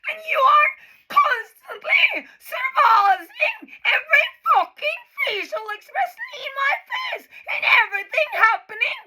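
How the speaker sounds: real breakdown, yelling while crying; screaming through tears. Real, not acted. Peak of tearful rant.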